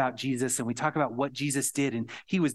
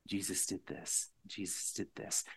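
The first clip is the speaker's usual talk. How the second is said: hushed; 4 semitones lower, 11 dB quieter than usual. Real, not acted. Quiet repeated emphasis.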